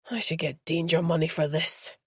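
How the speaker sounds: grumbling. A narrator's reading. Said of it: "the Easter bunny grumbled".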